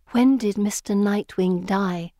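ordinary delivery, nothing marked

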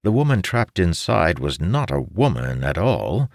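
usual speaking voice

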